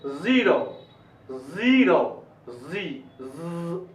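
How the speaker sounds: ज़ीरो, जी, ज़ each on a bee-like zzz; buzzing Z sound. Shown as a demonstration. Teacher isolates the sound learners need, long buzz in ज़ीरो, जी, ज़. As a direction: hold the z at the start of ज़ीरो. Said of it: "ये sound आना चाहिए".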